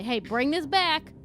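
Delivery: ordinary talk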